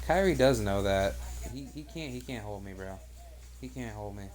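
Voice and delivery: ordinary delivery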